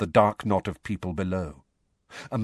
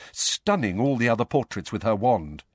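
plain reading voice

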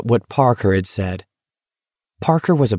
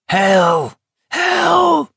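high, strained scream at moderate volume; narrator's held-back, high-pitched scream. A narrator's reading. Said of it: "Olson screamed".

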